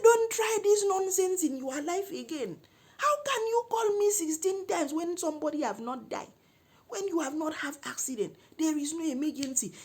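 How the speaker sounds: high, loud outraged rant; furious telling-off. Retold or imitated. Woman scolding man for calling sixteen times, furious. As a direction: berate the caller at high pitch.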